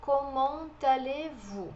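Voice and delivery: Comment allez-vous slowly, vous with a pure u; slow French demo. Shown as a demonstration. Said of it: "Aqui o som de U". (Comment allez-vous devagar, som de U no vous)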